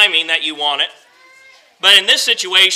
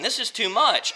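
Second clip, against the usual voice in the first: much softer, defeated; quiet despair; 8 dB quieter than usual. Acted. Preacher voicing Moses' defeated prayer, quiet despair. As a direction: let it sink, all fight gone.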